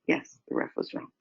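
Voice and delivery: softly whispered calm agreement; whispered acknowledgement. Retold or imitated. Scene: mom quietly agreeing with her son about the call, whisper. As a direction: breathe it out as simple validation.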